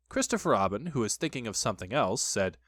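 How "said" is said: ordinary delivery, nothing marked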